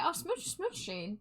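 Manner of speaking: high playful smooches; cutesy kisses. Acted. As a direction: coo them out to a fan. Blowing kisses to viewer.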